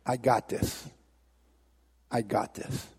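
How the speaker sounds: low, soft, quiet assurance; softly in control, pitched low; calm Jesus. Acted. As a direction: reassure him with hushed confidence. Jesus calmly takes over.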